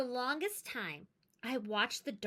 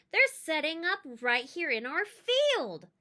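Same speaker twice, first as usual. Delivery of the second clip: a bit louder, excited call; excited shout; 6 semitones higher, 6 dB louder than usual. Acted. Brother runs to his sister, calling out excitedly. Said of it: "my brother shouted".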